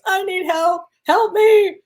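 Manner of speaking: high, loud pleading yell to the angels; reliving a frantic yell in the car; retold screaming. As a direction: cry out for help, half panicked. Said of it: "I was screaming at the angels".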